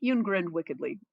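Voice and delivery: usual speaking voice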